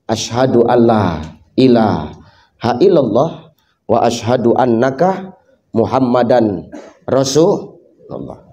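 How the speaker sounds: low, slow, faint last words; slow low whisper of faith at death; dying man's shahada. Acted. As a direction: breathe out the creed like a dying man. Dying man recites the shahada.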